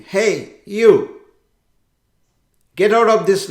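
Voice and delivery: first shout, loud but not yet at full force. Retold or imitated. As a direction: yell at the other boat to turn away. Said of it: "he started shouting".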